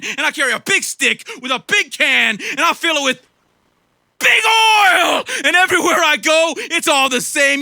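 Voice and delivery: high, loud, shouted megalomaniac rant; hammed-up screaming mogul; ranting cowboy tycoon. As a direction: climax of the satire, yelling at the crowd. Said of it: "that's me being a cowboy".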